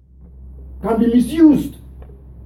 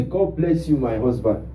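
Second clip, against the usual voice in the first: soft reply; 9 semitones lower, 6 dB quieter than usual. Pretend. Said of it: "and you say".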